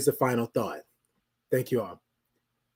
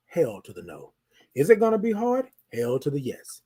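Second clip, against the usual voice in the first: usual speaking voice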